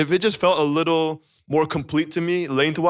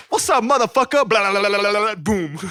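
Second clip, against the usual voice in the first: excited imitation; 5 semitones higher than usual. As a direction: burst out like the verse just dropped. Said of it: "Sorry, I'm getting excited".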